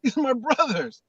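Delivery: high, loud, indignant voice, a laugh slipping in; shocked caged lion. Retold or imitated. Telling a lion story, voicing the caged lion: high, outraged protest. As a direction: play the lion who thinks chickens are his friends, appalled. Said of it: "caged lion is like".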